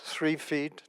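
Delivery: normal talking voice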